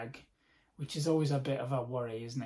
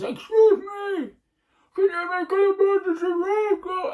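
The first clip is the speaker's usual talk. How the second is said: high-pitched pleading impression; high mock plea; 7 dB louder than usual. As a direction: squeak it out like a needy stranger. And he goes.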